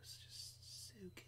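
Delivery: breathy, hushed whisper of delight. Real, not acted. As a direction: whisper it like a guilty pleasure. Savouring a favourite drink.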